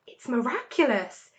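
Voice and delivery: usual speaking voice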